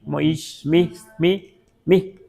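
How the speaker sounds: teacher stresses the repeated "mi" to show the break. Shown as a demonstration. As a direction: mark where the phrase breaks. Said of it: "fíjense dónde está el quiebre aquí".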